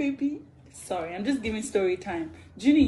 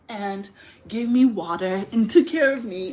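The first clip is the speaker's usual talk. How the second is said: ordinary delivery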